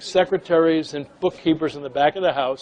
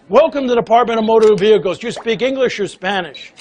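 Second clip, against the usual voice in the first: brisk, loud welcome, pitched up; eager greeting; 5 semitones higher, 6 dB louder than usual. Retold or imitated. Clerk charging up to greet a customer, eager and loud. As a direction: rush at the visitor with a quick welcome.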